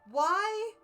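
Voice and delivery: normal talking voice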